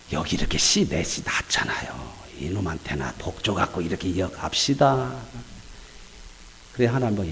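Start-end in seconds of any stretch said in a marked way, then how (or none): 0.1-5.1 whispered, sneaky bargaining with God; sly whisper. Acted. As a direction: whisper the deal like a secret. Abraham bargaining secretly with God. (하나님께 몰래 속삭이듯 흥정하는 목소리)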